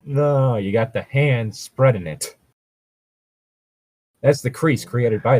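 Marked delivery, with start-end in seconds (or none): none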